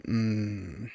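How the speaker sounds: low, soft, halting thanks; embarrassed father. Acted. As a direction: answer quietly after an awkward hesitation. Embarrassed father answers daughter.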